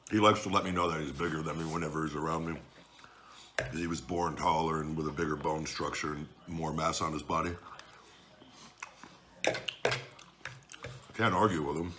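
talking between bites of pasta; chewing while talking. Real, not acted. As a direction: mouth partly full. Seen 3: took bites just before; wipes mouth, pasta on fork throughout.

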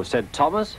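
usual speaking voice

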